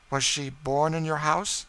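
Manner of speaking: keen probing lady; Madame Desault. A narrator's reading.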